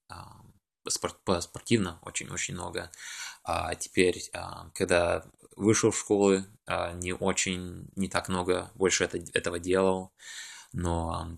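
ordinary talk, nothing marked

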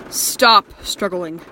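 slow, loud, firm order; stern command. Acted. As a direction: bark the order slowly. Captor orders bound captive.

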